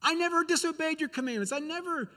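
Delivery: high-pitched, complaining son's protest; whiny aggrieved son. Retold or imitated. Older son complains to his father, mock whiny and high. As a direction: whine it like a son who feels cheated. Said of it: "He's like".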